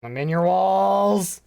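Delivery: slow, loud, high shout of walls; loud drawn-out shout. Acted. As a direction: belt it out slowly. Shouted creepy catchphrase.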